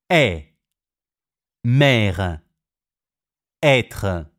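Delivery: "Mère" and "Être" with a wide, open è; open French e. Shown as a demonstration. Teacher showing open è to Italian learners, careful. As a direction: open the mouth on the è. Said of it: "si pronunciano aperte".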